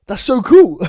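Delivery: high, loud awed coworker, laughing; gullible coworker. Acted. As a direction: gush like a naive coworker.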